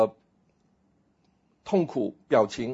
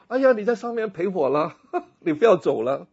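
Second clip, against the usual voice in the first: 1 pleading from the well; 6 semitones higher than usual. Pretend. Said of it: "我说".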